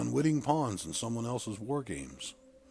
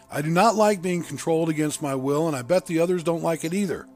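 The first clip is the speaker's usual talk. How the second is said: loud, forceful, higher-pitched protest; stern; 6 semitones higher, 8 dB louder than usual. A narrator's reading. Narrator voicing a controlled man demanding freedom for everyone, sternly. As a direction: press the objection firmly, voice raised. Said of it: "I said sternly".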